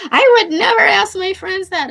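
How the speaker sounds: high, loud huffy retelling of the friend; indignant friend. Retold or imitated. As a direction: throw out her refusal, high and loud. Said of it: "And she's like".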